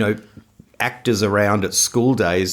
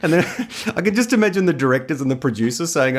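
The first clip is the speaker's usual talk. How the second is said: ordinary talk, nothing marked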